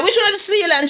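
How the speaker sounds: high-pitched imitated question; high questioning lady. Lady questions the servant, high mimicked voice. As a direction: ask it high, as the questioning missus.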